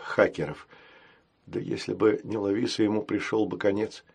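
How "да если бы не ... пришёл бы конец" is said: usual speaking voice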